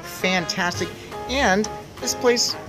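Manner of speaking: ordinary delivery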